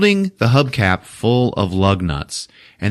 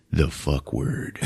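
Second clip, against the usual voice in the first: slow, low, soft mock voice-over; inner narrator; 5 semitones lower, 9 dB quieter, slower than usual. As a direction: drop it in like a solemn confession. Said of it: "in his mind go".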